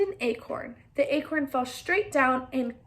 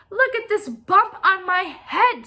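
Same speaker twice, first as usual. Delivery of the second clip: insistent hen; 6 semitones higher, 6 dB louder than usual. Acted.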